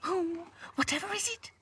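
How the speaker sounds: very high, frightened doll voice; whimpering. A narrator's reading. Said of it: "whimpered Lucy".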